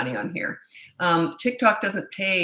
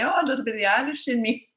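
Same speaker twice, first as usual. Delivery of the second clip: higher, quick Irish lilt; Irish accent; 5 semitones higher, faster than usual. Retold or imitated. Imitating herself on Saint Patty's Day, Irish accent. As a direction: put on a playful Irish brogue. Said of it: "So every Saint Patty's Day".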